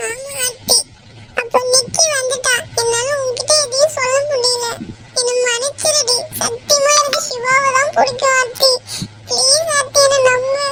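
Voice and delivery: soft, tearful plea for forgiveness; hushed, weepy pleading; crying. Acted. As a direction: beg quietly through tears. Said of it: "நீ அழாத".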